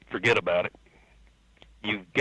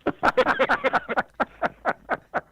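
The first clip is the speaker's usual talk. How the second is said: high-pitched burst of laughter; real laughter. Real, not acted. Laughing at the Chambers mix-up story, amused. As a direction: crack up for a couple of seconds.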